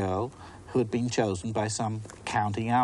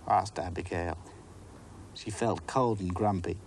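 usual speaking voice

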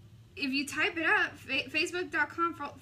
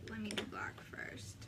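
whispered under the breath to herself; 2 semitones lower, 9 dB quieter than usual. Real, not acted. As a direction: murmure à mi-voix, presque chuchoté. Repeats line, then whispers.

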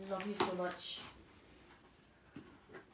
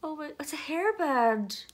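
delighted gasp; 5 semitones higher, 5 dB louder than usual. Real, not acted. Finding the hairband.

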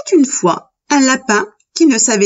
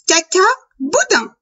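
high little rabbit voice; cheeky little rabbit; 9 semitones higher than usual. A narrator's reading. Little rabbit answering his father at midday, high cheeky voice. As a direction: pipe it up high, then let it fall.

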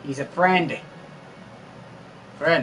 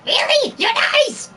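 put-on much higher, squeaky childish delight; Boo impression; 3 dB louder than usual. Boo charmed by a friendly fighter, high squeaky delight. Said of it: "My Boo impression".

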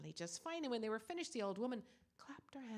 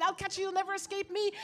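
chasing threat; 9 semitones higher, 11 dB louder than usual. Acted. Short man chasing her.

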